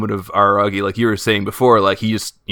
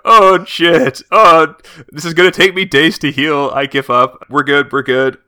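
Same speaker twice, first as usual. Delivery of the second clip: loud, high wailing in agony, easing off; hammed-up injured fighter, high and loud; acted pained cries; 7 semitones higher, 8 dB louder, slower than usual. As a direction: cry out in pain, then start giving up. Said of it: "crushes his eye. And he's just like".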